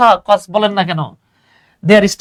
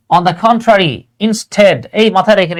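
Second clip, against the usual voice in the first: ordinary talk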